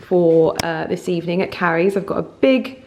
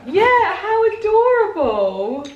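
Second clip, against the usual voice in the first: high, louder, drawn-out coo; delighted gushing; 13 semitones higher, 6 dB louder, slower than usual. Real, not acted. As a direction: gush over an adorable gift. Delighted by handmade gift.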